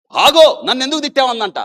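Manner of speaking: high, loud confronting cry; a wounded girl shouting at the nurse who insulted her; anguished shout. Retold or imitated. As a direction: demand an answer at the top of your voice.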